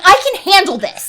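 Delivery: imitating a testy, grumpy man; a little angry. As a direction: act out mildly irritated insistence. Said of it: "and he gets a little angry".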